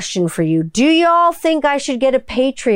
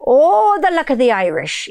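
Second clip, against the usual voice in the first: bad Irish accent with a high, swooping "Oh"; crazy-pirate Irish accent; 5 semitones higher than usual. Shown as a demonstration. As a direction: overdo the Irish until it turns pirate. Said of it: "they sound like a crazy pirate".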